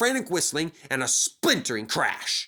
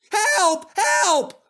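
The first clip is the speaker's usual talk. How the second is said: much higher, louder cry for help; panicked whistle; 7 dB louder than usual. Acted. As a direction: call for help as you slide. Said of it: "whistled Percy".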